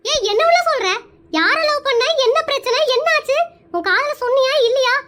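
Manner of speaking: high, agitated interrogation; alarmed questioning. Acted. Aunt presses niece about the secret love, high and agitated. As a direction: fire the questions at her in alarm.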